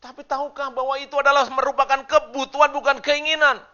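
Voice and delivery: loud, high, ringing sermon voice; full-force preaching. Acted. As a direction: preach it out to the whole church, slowing on the key lines. Khotbah dengan suara penuh. (khotbah berapi-api dengan suara lantang)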